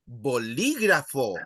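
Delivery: teacher's careful model of "Bolígrafo", stressing "lí". Shown as a demonstration. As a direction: punch the "lí" in "Bolígrafo". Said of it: "the emphasis on li".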